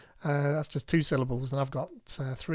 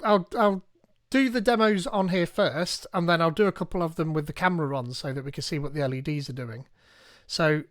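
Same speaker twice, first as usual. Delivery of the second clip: ordinary talk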